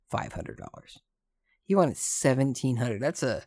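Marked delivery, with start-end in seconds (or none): 0.1-1.0 whisper. Real, not acted. Whispered aside.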